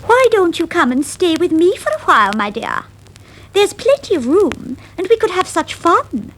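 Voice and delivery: high eager mouse voice; Lucy's voice. Acted. Lucy inviting her cousin to stay, high and eager. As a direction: invite her, bright and squeaky. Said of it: "said Lucy".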